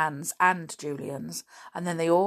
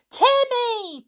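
high, loud call; calling desperately into the tunnels; shouting; 6 dB louder than usual. Acted. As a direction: shout for the dog with all her might. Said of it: "shouted George".

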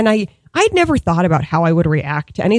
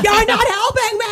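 high, furious yell; woman chasing a thief screams at bystanders; screaming at valets; 13 semitones higher than usual. Retold or imitated. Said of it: "Like, I'm just screaming".

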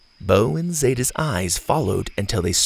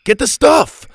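high loud get the stuff; impatient shout; 8 dB louder than usual. A narrator's reading. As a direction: yell the order from the door. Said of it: "Gavin called from the door".

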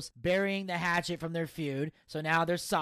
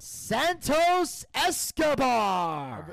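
long drawn-out, raised announcer call; ring-announcer intro; 6 semitones higher, 4 dB louder, much slower than usual. Retold or imitated. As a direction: stretch the name like a ring announcer.

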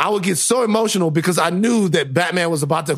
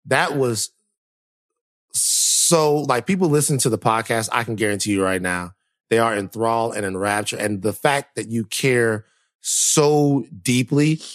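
reassuring; 6 semitones lower than usual. Real, not acted. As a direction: soothe her gently. Cohost comforting upset host.

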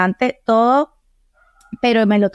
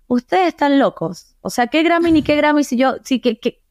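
higher, disbelieving protest; incredulous scoff; 7 semitones higher than usual. Retold or imitated. To her producers about the Grammys: high incredulous scoff. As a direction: brush off the idea as absurd, pitched up. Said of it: "Y yo".

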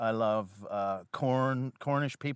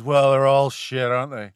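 fed-up pub moan; jaded grumble; 7 dB louder than usual. Retold or imitated. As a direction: sigh it out like a tired old cynic. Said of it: "one of those people who goes".

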